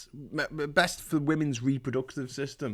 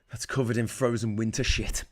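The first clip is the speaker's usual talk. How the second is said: ordinary delivery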